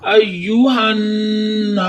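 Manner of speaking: अय्युहन्ना recited slowly with the alif stretched one count; slow Quranic recitation of अय्युहन्ना. Shown as a demonstration. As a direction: chant अय्युहन्ना in tajweed style, drawing out the long vowel. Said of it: "एक अलिफ की मकदार खींच कर".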